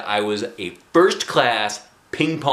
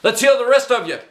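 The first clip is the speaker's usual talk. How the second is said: raised, eager karate teacher; 12 semitones higher, 5 dB louder than usual. Acted. Karate teacher lecturing kids, higher and brighter.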